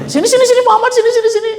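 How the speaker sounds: eager calling. Acted. Musa eagerly calling Muhammad to come over. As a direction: beckon him over with a grin.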